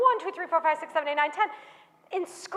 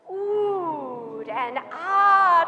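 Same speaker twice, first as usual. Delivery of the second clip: drawn-out oohed; 5 semitones lower, much slower than usual. A narrator's reading. Drawing out the crowd's wonder.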